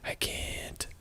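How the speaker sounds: retold refusal in a hush; whispered reply. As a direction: whisper it, helpless. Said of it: "And I'm all like".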